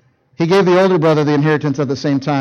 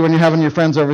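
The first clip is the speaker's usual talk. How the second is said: normal talking voice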